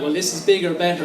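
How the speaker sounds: normal talking voice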